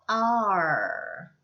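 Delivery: R as a long curled vowel; sound of A R. Shown as a demonstration. Teacher answering what A R sounds like, long R. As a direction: hold R out with the tongue pulled back. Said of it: "에이알은요".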